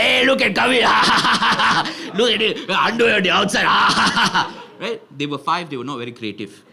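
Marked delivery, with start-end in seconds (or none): 0.0-0.8 loud mock shout, taunting; acted playground jeer, louder; jeering kids. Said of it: "They all looked at me like". 0.9-2.1 loud acted kids' cackle; exaggerated taunting laugh; mocking laughter. Friends mocking him, acted laugh. 2.2-3.6 loud mock taunt through laughter; acted shouting and laughing ridicule; jeering kids. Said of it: "They all looked at me like". 3.7-4.7 loud acted kids' cackle; exaggerated taunting laugh; mocking laughter. Friends mocking him, acted laugh.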